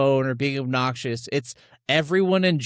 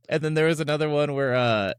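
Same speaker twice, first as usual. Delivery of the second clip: usual speaking voice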